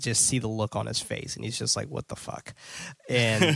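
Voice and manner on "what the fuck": hushed, voiceless imitation of his dismay; low-volume mock disbelief; unhappy disbelief. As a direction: say it under the breath, as his silent reaction. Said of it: "definitely not happy by this arrangement".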